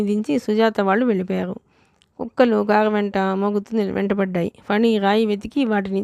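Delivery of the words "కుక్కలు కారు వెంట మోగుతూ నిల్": ordinary delivery, nothing marked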